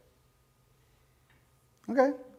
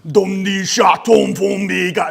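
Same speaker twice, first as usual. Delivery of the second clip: mock incantation; 8 dB louder, slower than usual. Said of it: "I'm like".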